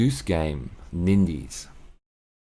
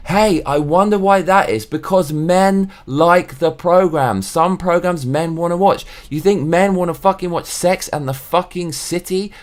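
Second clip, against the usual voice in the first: indignant rant; 8 semitones higher, 4 dB louder than usual. Real, not acted. Ranting about men's TV.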